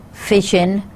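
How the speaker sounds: "fishin" with the final g dropped, -in. Shown as a demonstration. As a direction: say "fishin", the ending as -in. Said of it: "the following pronunciations".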